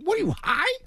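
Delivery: mocking squeaky disbelief; incredulous high voice. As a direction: play the scoffing Mets exec. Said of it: "The Mets like".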